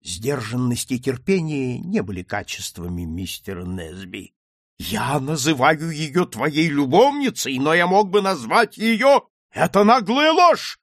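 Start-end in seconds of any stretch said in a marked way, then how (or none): 4.8-10.8 raised voice climbing to a loud cry; high, loud quarrelling; heated accusation. Acted. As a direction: build from insistence to outrage. Heated quarrel over an insult.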